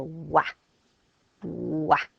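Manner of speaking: playful fake baby wail on "wah."; hammed-up crying baby for children; mock baby crying. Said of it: "this baby's crying".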